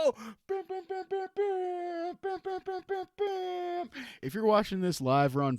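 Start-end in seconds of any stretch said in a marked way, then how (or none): 0.0-3.9 high gasped "oh", then high soft bams; vocal jingle of repeated high-pitched bams; imitated fanfare. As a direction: chant the bams like a little horn riff.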